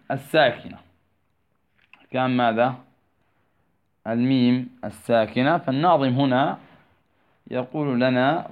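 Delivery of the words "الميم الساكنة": ordinary talk, nothing marked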